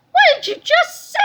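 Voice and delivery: very high, loud outraged cry; secretary cries out, very high and loud. A narrator's reading. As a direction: screech in disbelief. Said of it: "she cried in a voice".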